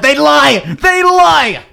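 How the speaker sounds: high outraged cry of "They lie!"; indignant outcry. Acted. Outraged accusation in debate.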